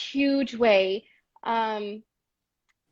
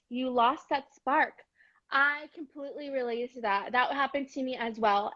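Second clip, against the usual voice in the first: usual speaking voice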